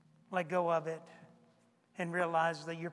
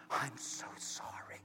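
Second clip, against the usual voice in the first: whispered apology; 9 semitones lower, 10 dB quieter than usual. Retold or imitated. Old man apologizing, crying.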